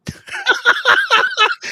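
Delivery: squealing burst of laughter; cracking up at own Aussie bit; high laugh. Real, not acted.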